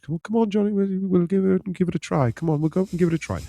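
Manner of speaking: high, sing-song English mum voice; put-on chirpy cartoon English accent; Peppa Pig English accent. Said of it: "Peppa Pig kind of accent".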